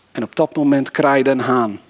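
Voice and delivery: ordinary talk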